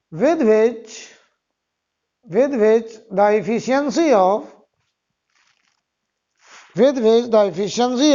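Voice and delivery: slow, repeated dictation; dictating. Shown as a demonstration. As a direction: read each phrase out for copying. Dictating second point.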